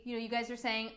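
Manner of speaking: ordinary talk, nothing marked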